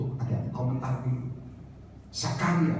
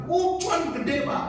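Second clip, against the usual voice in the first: high-pitched mock glee; gleeful caller; 11 semitones higher, much slower than usual. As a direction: gloat happily over a big profit. Penelepon menjawab untung besar. (meniru jawaban girang bernada tinggi)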